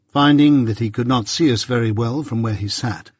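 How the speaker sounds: ordinary delivery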